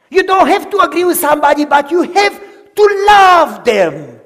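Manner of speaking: loud, high fervent sermon; full-force preaching. Acted. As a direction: preach it out to the congregation.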